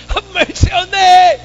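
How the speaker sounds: very high, loud, slow cry; blind man's shout. Acted. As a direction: shout over everyone, stretch it. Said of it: "Anong sinigaw niya?".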